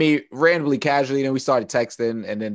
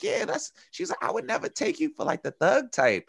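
imitated girl; 13 semitones higher than usual. Quoting his date on the thug type, mock girlish. Voicing the girl's reply.

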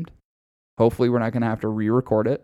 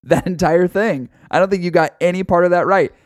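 louder, higher incredulous comeback; exasperated retort; 6 semitones higher, 5 dB louder than usual. Retold or imitated. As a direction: scold him in disbelief. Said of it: "And I was like".

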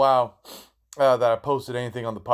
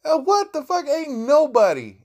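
pitched way up and louder in disbelief; incredulous outburst; 4 dB louder than usual. Real, not acted. Ranting to listeners about the teen's excuse, high incredulous outburst.

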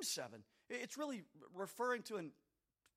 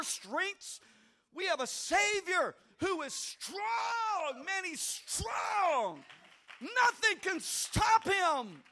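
high, loud, slow preaching cry; fervent high preaching shout; preaching at full force; 8 semitones higher, 8 dB louder, much slower than usual. Acted. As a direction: cry it out over the congregation, slowly. Preaching climax.

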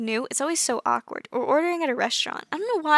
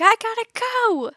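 high, loud mock-desperate plea; 10 semitones higher, 6 dB louder than usual. Caller cutting off a talkative relative, mock desperate. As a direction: wail it as an acted plea to escape. Acting urgent end of call.

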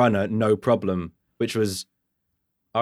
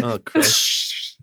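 dismayed groan; 3 semitones lower, much slower than usual. Retold or imitated. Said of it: "And you're like".

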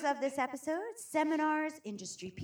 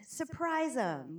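ordinary talk

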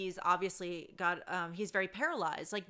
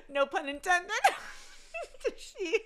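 laughing, louder and high; 9 semitones higher, 5 dB louder than usual. Real, not acted. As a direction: giggle through the apology for the pun. Laughs at own pun.

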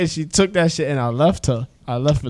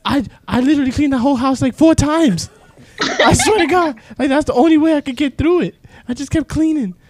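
very high, loud panicked mom voice; hammed-up mother reliving her paranoia; a mom telling her son how high she got, in a shrill mock voice; imitating mom; 5 dB louder than usual. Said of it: "She's like".

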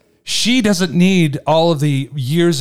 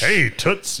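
higher greaser tough guy come-on; sleazy guy voice; 6 semitones higher than usual. Retold or imitated. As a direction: echo the smarmy catcall. Echoes the sleazy voice.

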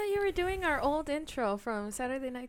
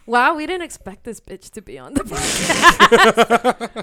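loud laughing talk; 16 dB louder than usual. Real, not acted. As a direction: tell it while cracking up. Laughing through recounting.

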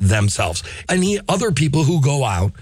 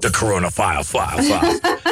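repeating the word like a fading echo; comic mouth-made echo on a show title; mock echo effect; 5 semitones lower than usual. Shown as a demonstration. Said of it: "There's an effect there".

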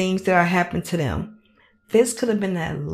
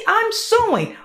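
very high-pitched indignant character; indignant threat; 12 semitones higher than usual. Acted. Penelope threatening legal action, high indignant voice. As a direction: announce it in a high, put-on voice.

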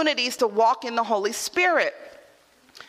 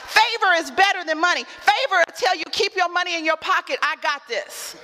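preaching at full force; 3 semitones higher than usual. Acted. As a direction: preach it out to the congregation.

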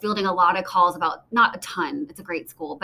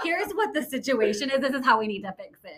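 laughing imitation; 5 semitones higher than usual. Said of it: "or, like".

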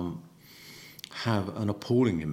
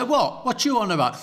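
mum's baffled cry, pitched much higher and louder; puzzled mum; 12 semitones higher, 5 dB louder than usual. Retold or imitated. Mum baffled when her son comes home calling himself posh. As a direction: snap back in disbelief as Mum. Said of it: "my mum would say".

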